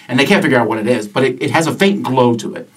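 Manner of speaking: ordinary delivery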